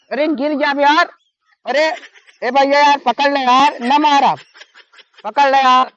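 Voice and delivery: frantic yelling for help; panicked shouting. Real, not acted. Panicked shouting, someone falling.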